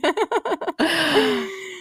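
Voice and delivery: high-pitched giggling laugh. Real, not acted. Host laughs after joking "where are you", giggly. Laughing at the nice guy joke.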